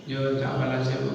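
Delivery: soft, low beggar's plea; pleading beggar. Acted. Beggar asking a passer-by for pity, softly pleading.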